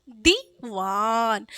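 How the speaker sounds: Diwan with a long drawn दी; patient slow repeat; slow sound-out. Shown as a demonstration. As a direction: stretch each syllable of Diwan. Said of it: "क्या?".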